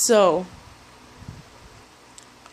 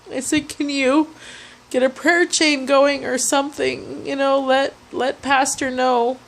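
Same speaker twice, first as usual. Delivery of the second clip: retold distress; 5 semitones higher than usual. Retelling frantic call to friend.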